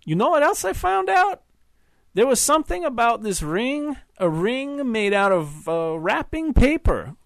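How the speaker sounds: put-on husband needling his wife's old fiancé, slurring drunkenly; drunken slur. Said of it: "said in a slurred accent".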